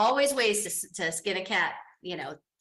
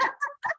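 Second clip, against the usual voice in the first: short high delighted laugh. Real, not acted. Teacher laughing at her own song, high laugh.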